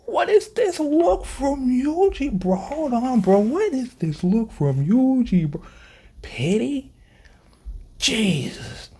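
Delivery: high, slow, near-crying disbelief; stunned excitement. Real, not acted. Shocked at Yuji's look, high near-crying disbelief.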